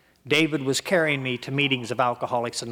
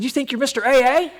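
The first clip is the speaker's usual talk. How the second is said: indignant retort; 12 semitones higher, 7 dB louder than usual. Retold or imitated.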